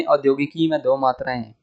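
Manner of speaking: ordinary delivery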